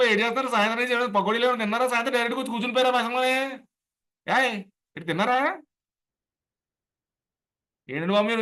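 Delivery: fast, high East Godavari chatter; East Godavari dialect. Shown as a demonstration. A trainer showing how East Godavari folk talk among themselves, fast and high. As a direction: rattle it off in quick East Godavari Telugu. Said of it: "చాలా స్పీడ్గా మాట్లాడతారు".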